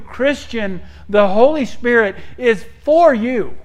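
high, loud, insistent preacher; full-force preaching. Real, not acted. Preacher urging the congregation that the Spirit is for them, full-force preaching. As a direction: drive each point home with rising intensity.

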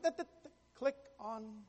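slow, muttered repeating of the words; mom writing it down. Retold or imitated. Son imitating mom copying his instructions word by word, slow mutter. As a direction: echo each word slowly as if copying it onto paper. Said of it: "I could hear her on the other end".

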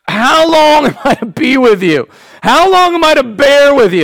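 Jesus fed up with his disciples; frustrated Jesus. Acted. Jesus scolding the faithless generation, exasperated. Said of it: "feel frustration oozing out of him".

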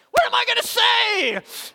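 high, loud, slow panicked cry; freaking out. Retold or imitated. Young minister panicking in the corner before preaching, high loud wail. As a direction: wail it like a man about to be exposed. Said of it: "to freak out".

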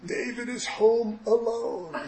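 high, slow dawning horror; horrified realization. Acted. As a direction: stretch it out in acted alarm. Realizes child was left alone.

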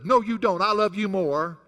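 daughter's all-caps reply, voiced loud; mock capital-letter shout. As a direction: read her caps as a loud tease. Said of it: "write back with big capital letters".

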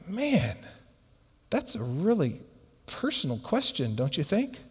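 soft, halting objection; imitating a man put on the spot; uncomfortable. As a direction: push back gently and quietly. Voices the uncomfortable man's objection.

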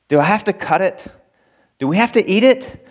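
high-pitched timid imitation of a woman; hesitant, pleading questions, acted high. Agnes asks about cutting her cake, timidly pleading.

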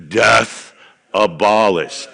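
loud, strong "Death abolished"; strong voice, not really deep; strong declaration. Shown as a demonstration. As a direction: say it strong and firm. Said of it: "kind of a deep voice... strong if you want to".

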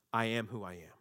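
calm final answer; solemn divine answer. Acted. God revealing his name to Moses, low and calm.

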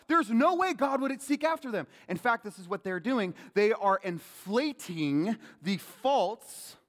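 ordinary talk, nothing marked

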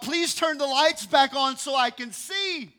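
high, loud and slow plea; loud, slow, high begging; loud pleading prayer. Retold or imitated. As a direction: cry out to God for light. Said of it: "so he says to God".

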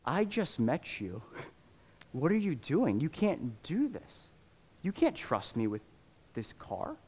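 hushed, slow, choked-up disbelief; a retold tearful plea, kept quiet; fighting back tears. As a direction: softly hold back tears as you protest. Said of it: "like, fighting back tears and is like".

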